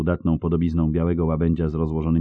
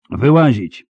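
louder, higher command; raised-voice military command; shouted order; 6 semitones higher, 6 dB louder, slower than usual. Acted. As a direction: call it out as a sharp order. Knights shouting orders at fugitives.

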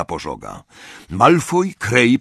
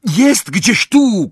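high, loud, drawn-out call; shouting; 11 semitones higher, 6 dB louder, much slower than usual. A narrator's reading. Harry pointing Hermiona to the junk pile, shouting. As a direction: call it out slowly and loudly. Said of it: "krzyknął do niej Harry".

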